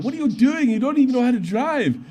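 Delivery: high, loud retold yelling; angry German driver. German driver yelling at the sergeant after a fender bender, angry. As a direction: shout the driver down for bad driving. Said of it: "German came out".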